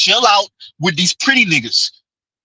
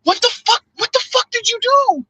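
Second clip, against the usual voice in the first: disbelieving cry; 13 semitones higher than usual. Pretend. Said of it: "you see certain women and you like".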